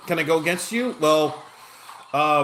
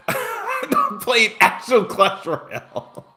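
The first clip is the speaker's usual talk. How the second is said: laughing; 9 semitones higher, much slower than usual. Real, not acted. Laughing at spam suggestion.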